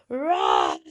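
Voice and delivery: low, loud roaring growl; a deep bear grunt. Acted. Bear roaring back at the old woman.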